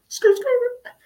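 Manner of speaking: shrill squealed skirt skirt; squealing tires. Pretend. Getaway car noise, shrill squeal. Sound effect joke.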